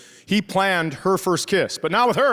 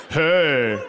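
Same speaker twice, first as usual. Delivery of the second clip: put-on boyfriend's hey; 3 semitones lower than usual. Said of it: "It's like".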